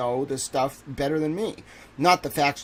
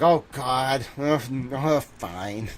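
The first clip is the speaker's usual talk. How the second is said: reluctant sigh; much slower than usual. Retold or imitated. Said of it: "Eddie's probably like".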